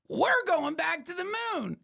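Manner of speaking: sudden high, loud burst of excitement; excited announcement. Real, not acted. Host telling viewers about the moon trip, high and thrilled. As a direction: nearly shout the good news.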